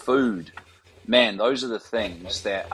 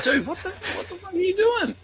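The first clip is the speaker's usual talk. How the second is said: much higher, half-laughing disbelief; shocked outburst. Retold or imitated. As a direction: yelp it out in total disbelief. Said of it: "And I'm like".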